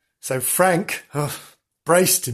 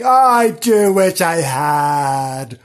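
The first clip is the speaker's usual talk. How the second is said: long, loud sighed-out wish; wistful sighing; 2 semitones higher, 6 dB louder, much slower than usual. Acted. Lonely character longing for a friend, drawn-out sighing. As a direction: stretch every word into a slow sigh. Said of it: "he kept sighing".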